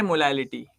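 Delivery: मोलालिटी with a lisped l for r; lisping. Shown as a demonstration. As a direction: say मोलालिटी as a lisper would, r softened to l. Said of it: "तोतला जाओ।".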